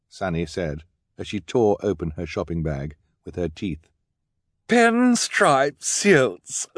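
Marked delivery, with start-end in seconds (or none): 4.7-6.7 high, loud, drawn-out squeal of delight; hammed-up thrill with a big gasp; gushing excitement. Esme presenting pinstripe suits, gushingly excited.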